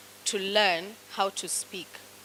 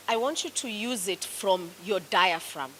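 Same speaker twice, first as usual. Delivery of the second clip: normal talking voice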